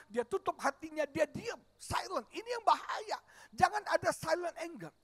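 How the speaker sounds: loud revival preaching; preaching at full force. Acted. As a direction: drive it home to the congregation. Pastor preaches about his anger.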